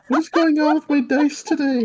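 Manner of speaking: high, loud laughing disbelief. Real, not acted. Laughing at bad rolls.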